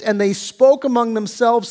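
usual speaking voice

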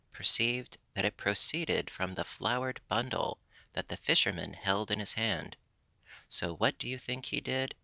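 ordinary delivery

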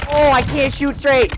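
loud, high exasperated complaint; frustrated yell. Real, not acted. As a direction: yell it out, fed up. Frustrated gamer complaining loudly.

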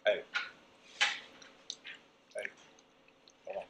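normal talking voice

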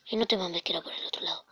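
hushed frustrated whisper. Real, not acted. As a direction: breathe it out under your breath. Muttered frustration at a game.

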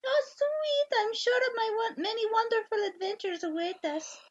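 put-on high lilting French accent. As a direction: purr it like a French madame. Same French character continues.

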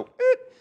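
quick high, loud screech; imitated brake stomp. Explaining what station wagons don't like, loud imitated screech. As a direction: mimic jerking the car with one sharp noise. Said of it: "they don't like it when you go".

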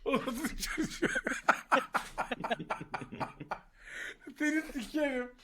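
cracking up mid-sentence; laughing. Real, not acted. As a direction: talk through helpless laughter. Friend calls grandpa pompacı. (gülmekten kırılarak)

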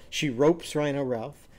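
ordinary delivery

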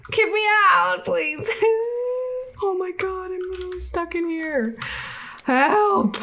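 frantic laughing panic; panicked yelling. Real, not acted. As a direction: yell to be let out. Stuck in game, calling help.

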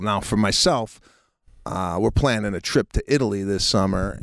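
upbeat sponsor read; bright ad voice. Acted. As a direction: sell the app with energy.

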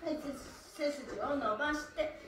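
ordinary delivery

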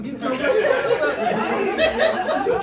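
mock hyped yell; excited yell. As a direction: shout her on, laughing. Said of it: "So I said".

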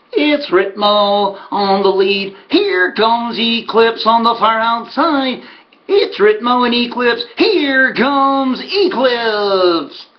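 high, drawn-out track commentary; race caller. Retold or imitated. Horseman calls Eclipse catching Ritmo on the turn home, race-caller voice. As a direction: stretch the words as the finish builds.

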